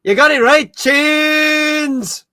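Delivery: loud, high put-on Irish accent. Shown as a demonstration. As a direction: belt out a hammed-up Irish accent, drawing out the last word. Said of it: "Best Irish accent".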